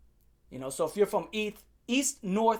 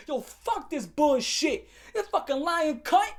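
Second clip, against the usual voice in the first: high, drawn-out angry cursing; tripping out; 7 semitones higher, slower than usual. Retold or imitated. Student refusing to calm down after punching lockers, tripping out. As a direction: drag out each curse, refusing to calm down. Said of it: "so I started tripping".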